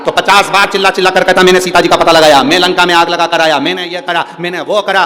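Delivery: loud bragging cry; boastful shout. Pretend. Preacher mocking a boaster shouting to everyone, loud. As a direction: shout it out like a show-off.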